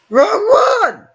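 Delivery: high frustrated shriek; raging gamer. Real, not acted. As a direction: yell it in a high squeal. Player yells at wrong choice.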